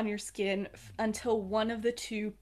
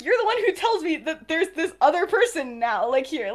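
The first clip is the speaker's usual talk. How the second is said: higher, louder mock protest; worked up; 6 semitones higher, 9 dB louder than usual. Regulus throwing it back at Sirius, worked up. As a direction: retell it as an agitated complaint. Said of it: "he's a little worked up".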